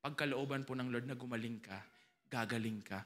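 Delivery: a hint of a doubtful prayer; timid prayer. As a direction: murmur it without conviction. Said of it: "hindi na lumalabas sa bibig ko".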